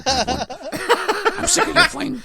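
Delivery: hammed-up Irish Batman, half laughing; wavering Batman impression. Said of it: "I keep going between this".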